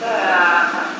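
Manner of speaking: ordinary talk, nothing marked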